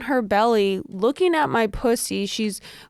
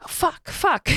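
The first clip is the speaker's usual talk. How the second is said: higher-pitched little mock curse; mock frustration; 7 semitones higher than usual. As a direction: act out exasperation, pitched up. Acting out frustration as it slips.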